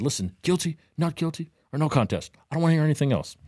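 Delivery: a hint of an exasperated judge; mild mock judge scolding the court; stern judge.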